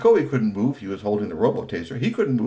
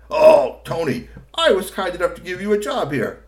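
high, loud fake kindness; smug boss; 8 semitones higher, 6 dB louder than usual. A narrator's reading. Kusumono to Tony, smug and condescending. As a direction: lord it over the worker. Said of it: "full of himself".

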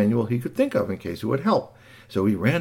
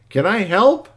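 robot offering help; 10 semitones higher, 7 dB louder, slower than usual. Acted.

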